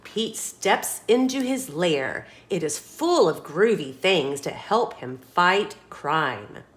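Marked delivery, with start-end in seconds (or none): none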